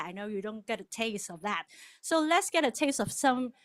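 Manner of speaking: ordinary talk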